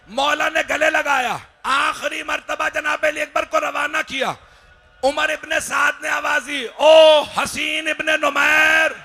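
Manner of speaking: high, urgent lament building to a loud cry; impassioned majlis preaching. Acted. Preacher tells of the spear thrown at Ali Akbar, impassioned preaching. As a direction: drive the tragedy to a shouted climax.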